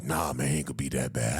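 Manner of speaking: low, casual big bro; lightly voiced big brother. Imitating big bro playing it cool, low voice.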